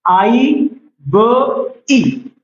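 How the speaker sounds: spelled out slowly. Shown as a demonstration. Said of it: "IB कैसे लिखेंगे हम?".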